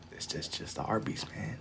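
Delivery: whisper. Real, not acted. Musing aloud over chat.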